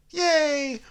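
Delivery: very high squeaky yay; a goofy little cheer; silly cheer. Acted.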